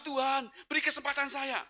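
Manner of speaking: high, strained gasped plea; desperate prayer aloud. Retold or imitated. Breathless driver begs God for a chance, desperate cry. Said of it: "Saya sudah nggak berani doa lagi".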